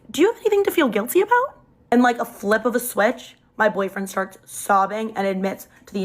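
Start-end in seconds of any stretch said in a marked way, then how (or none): 0.1-1.6 higher, louder, fast pointed jab; pointed question. Retold or imitated. To the cheating boyfriend, a sharp pointed accusation. Turning the accusation back on him.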